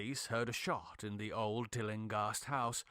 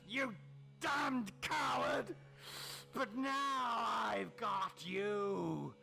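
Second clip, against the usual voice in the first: furious shout; 8 semitones higher, much slower than usual. Acted. Madman rages at friend.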